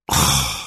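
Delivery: low, long weary sigh; heavy sigh. A narrator's reading. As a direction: sigh in dismay. Bad news about home.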